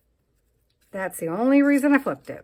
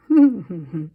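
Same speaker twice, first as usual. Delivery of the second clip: short loud laugh; 9 dB louder than usual. Real, not acted. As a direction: burst out laughing. Laugh alone.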